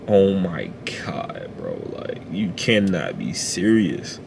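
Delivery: slow, breathless whisper of disbelief; shocked whisper. Retold or imitated. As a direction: whisper it slowly, stunned.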